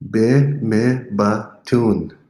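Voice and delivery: Be me batun very slow and drawn out; slow model phrase. Shown as a demonstration. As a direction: lay out each syllable for learners. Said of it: "teacher models phrase".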